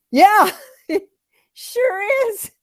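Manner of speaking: high, squeaky, laughing reply; nervous fake cheer. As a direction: cover guilt with a bright laugh. Hiding candy breath from Marty.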